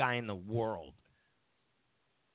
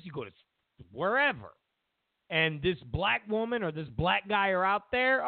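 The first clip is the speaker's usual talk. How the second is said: normal talking voice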